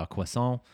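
croissant as "kwess-ON", no r or t; affected French pronunciation. Retold or imitated. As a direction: imitate a would-be Frenchman saying croissant as "kwess-ON". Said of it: "why you're saying it like this".

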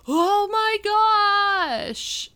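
high, drawn-out horrified Oh my gosh; shocked gasp. Retold or imitated. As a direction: shriek it slowly at the mirror in horror. Sees her hacked hair in mirror.

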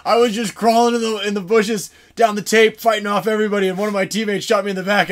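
loud, high mock grumbling; hammed-up tale of woe; retold exasperated complaint. Downed player explains his plight.

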